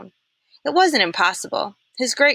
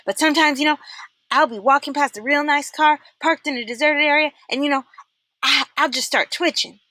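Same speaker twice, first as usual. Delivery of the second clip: Twitch voice; 8 semitones higher than usual. A narrator's reading. Twitch explains himself.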